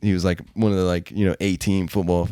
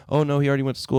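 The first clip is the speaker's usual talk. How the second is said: mom voice; 6 semitones higher, much faster than usual. Retold or imitated.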